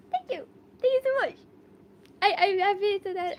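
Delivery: very high-pitched, gushing thank-you; touched thanks. Real, not acted. Streamer thanking a viewer for a compliment, high gushing thanks. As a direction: go high and sweet with gratitude. Answers a compliment on her eyes.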